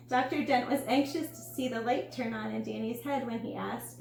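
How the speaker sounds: plain reading voice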